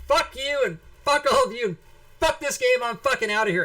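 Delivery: screamed high and loud, cursing out the whole table; furious shout. Retold or imitated. Fifteen-year-old player quitting the game at the table, furious shouting. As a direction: blow up and quit the game at full volume.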